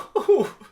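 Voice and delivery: high, loud delighted laugh; giddy laughter; gleeful laugh. Acted. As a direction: laugh with pure joy. Barry laughing in flight.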